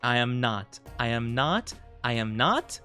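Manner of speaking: ordinary talk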